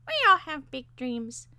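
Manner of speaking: high bird voice, musing; philosophical parakeet. A narrator's reading. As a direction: philosophise as a squeaky little bird. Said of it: "said the parakeet philosophically".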